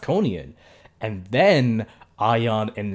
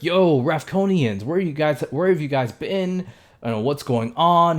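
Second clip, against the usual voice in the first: mock freaking out, raised voice; imitated excited freak-out; 5 semitones higher, 4 dB louder than usual. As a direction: gush questions like an overexcited fan. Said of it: "immediately starts freaking out".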